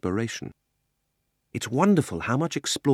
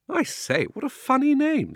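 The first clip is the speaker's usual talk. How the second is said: much higher, louder mocking girl's voice; teasing schoolgirl; 6 dB louder than usual. Polly teasing Diggory about his name, high and mocking. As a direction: squeal it up high like a cheeky girl.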